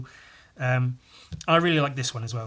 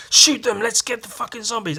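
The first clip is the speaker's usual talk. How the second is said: mock shout; 9 semitones higher, 5 dB louder than usual. Soldiers shout at fleeing zombies.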